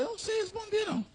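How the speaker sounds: high, hushed little voice; sheepish aside. Retold or imitated. Pastor admitting to the waitress he has no answer, sheepish aside.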